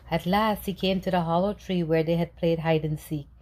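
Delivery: usual speaking voice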